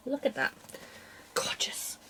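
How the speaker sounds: awed whisper. Real, not acted. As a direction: breathe the word out in admiration. Admiring her fabric up close.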